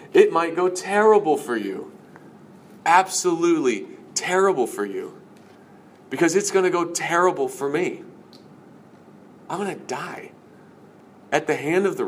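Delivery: high, forceful acted warning; emphatic warning. Jesus warning followers of suffering, acted emphatic warning.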